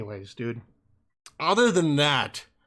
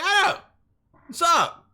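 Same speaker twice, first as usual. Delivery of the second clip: much higher, casual mock-cheerful dad; dad's greeting; 12 semitones higher, 3 dB louder, slower than usual. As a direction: chirp it like a dad catching you out. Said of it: "And he's like".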